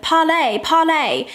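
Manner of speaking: high, falling mock British accent. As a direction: imitate a film heroine's British accent. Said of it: "in her sexy British accent".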